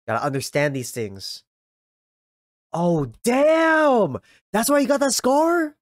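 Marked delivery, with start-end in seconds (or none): 2.7-5.8 shouted oh damn, high and loud; stunned shout at the twist; loud surprise. Real, not acted. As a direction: blurt it out, stunned. Shocked realization at scene.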